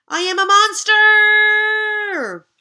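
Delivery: slow, very loud, high monster voice; monster roar. Acted. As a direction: bellow it out like a big monster. Monster announces itself.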